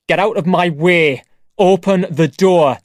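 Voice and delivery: loud, angry demand; angry teenage brother yelling at his dad. Acted. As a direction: shove the words at him, furious. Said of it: "He was very angry".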